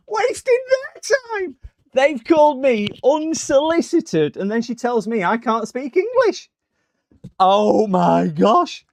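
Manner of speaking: high, loud, indignant squawk; mock outrage. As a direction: exclaim it in hammed-up, drawn-out disbelief. Mock outrage after scam caller hangs up.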